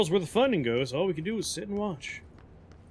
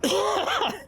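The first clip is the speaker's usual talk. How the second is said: silly clown laugh; 13 semitones higher than usual. Real, not acted. Laughs while smashing.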